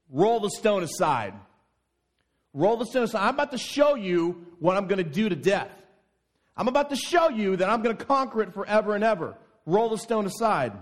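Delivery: loud, high commanding shout; angry command. Acted. Jesus ordering the crowd, angry loud command. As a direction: order them to roll it away, furious. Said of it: "Jesus was still angry".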